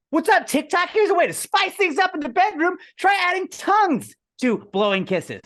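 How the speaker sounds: very high, loud influencer pitch; perky creator hyping a life hack; TikTok influencer voice. Pretend. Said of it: "Ready, ready for the".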